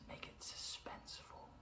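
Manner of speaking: hushed, breathy whisper; suspenseful whisper. Shown as a demonstration. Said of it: "slow down and lower your voice".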